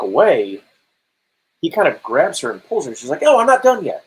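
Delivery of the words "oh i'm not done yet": high, louder mock protest; protesting game character. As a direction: voice a girl who won't be pulled away. Voices game character pulling away.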